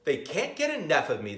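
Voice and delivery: ordinary delivery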